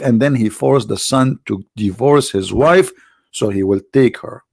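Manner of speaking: normal talking voice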